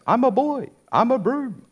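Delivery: high squeaky puppet voice; Pinocchio's voice. Retold or imitated. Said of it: "He goes".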